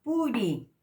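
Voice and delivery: "Puri" said loudly with a Spanish-style p; plain p model. Shown as a demonstration. Teacher modelling the plain p. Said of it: "La P, como en castellano".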